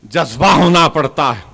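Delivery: ordinary talk, nothing marked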